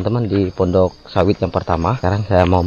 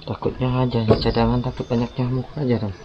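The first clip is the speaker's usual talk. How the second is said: quiet; 3 semitones higher, 12 dB quieter than usual. Real, not acted. Checking net quietly at night.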